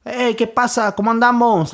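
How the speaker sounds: playful high-pitched Spanish greeting; joking greeting. Retold or imitated. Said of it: "I always clown".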